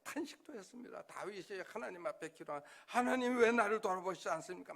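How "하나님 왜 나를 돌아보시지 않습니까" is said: crying out to God. Retold or imitated. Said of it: "막 기도했어요".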